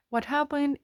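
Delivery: usual speaking voice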